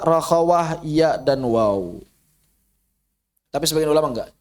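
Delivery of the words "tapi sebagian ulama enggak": ordinary talk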